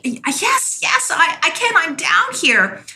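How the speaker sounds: high, eager answer; stranded man answering the voice above, relieved and eager; eager reply. Retold or imitated. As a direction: grab at the hope in the voice.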